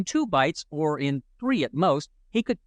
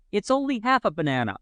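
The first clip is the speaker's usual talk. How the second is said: shouting; 6 semitones higher than usual. A narrator's reading. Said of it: "Pee-wee shouted".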